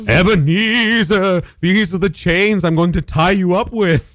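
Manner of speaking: slow, high, booming ghost. Pretend. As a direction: intone like a spooky ghost.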